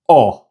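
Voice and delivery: "O" as a short, rounded AW as in not. Shown as a demonstration. Teacher isolating the Spanish vowel for learners, short "O". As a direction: say "O" as one pure, short vowel. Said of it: "the same short O sound".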